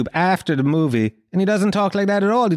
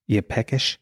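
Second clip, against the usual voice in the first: low, softer tempting voice; food app notification; 6 semitones lower, 4 dB quieter than usual. Acted. Second food app nudging a dieter, low and coaxing. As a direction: slip it in low and quiet.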